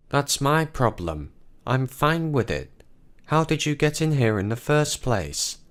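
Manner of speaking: put-on high young boy, matter-of-fact; a small kid acting grown-up; boy's voice. Boy questions how the women got in, high child's voice.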